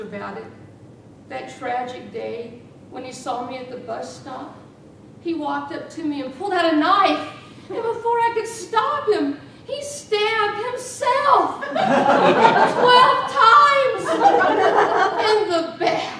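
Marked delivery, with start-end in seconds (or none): 5.2-16.1 much higher and louder, slow, laughing; laughing through the story. Acted. Widow laughing as she claims he stabbed himself in the back. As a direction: savour the absurd lie with glee.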